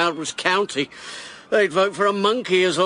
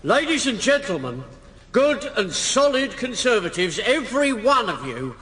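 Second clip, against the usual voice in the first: raised, projecting political oratory; rally speech; 4 semitones higher than usual. Acted. Candidate addressing the Conservative rally, rousing. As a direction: work the crowd from the platform.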